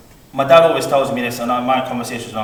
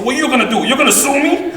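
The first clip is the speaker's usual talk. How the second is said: high, louder mocking challenge with a laugh; Uncle Mike taunt; 12 semitones higher, 5 dB louder than usual. Nephew imitating uncle in a heated argument, high mocking. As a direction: dare them, amused and much higher. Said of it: "he said".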